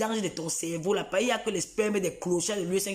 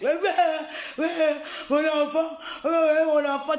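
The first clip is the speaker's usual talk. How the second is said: high, slow, laughing fake sob; hammed-up weeping over her child; mock tearful lament; 6 semitones higher, 3 dB louder, slower than usual. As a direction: wail it like a grieving mother, half laughing. Mocking her crying lament.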